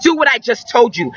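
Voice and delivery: ordinary talk, nothing marked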